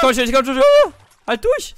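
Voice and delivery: high excited shouting; frantic urging. Real, not acted. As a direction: cheer them on in panic. Urging during danger, shouting.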